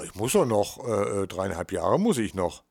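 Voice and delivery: ordinary talk, nothing marked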